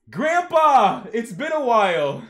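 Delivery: high, very loud holler; exuberant greeting. Acted. Grandson greets grandpa on the phone, exuberant shout. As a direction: belt out a gleeful hello.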